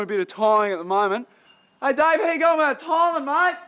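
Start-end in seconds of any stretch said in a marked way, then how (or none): 1.8-3.6 raised, higher call across the site. Acted. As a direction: shout over to the worker. Boss calls out across site.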